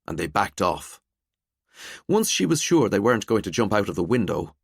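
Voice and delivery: ordinary delivery, nothing marked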